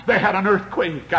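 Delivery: rising and falling revival preacher, shouting peaks; fiery preaching. Acted. Revival preacher to his congregation, fiery preaching.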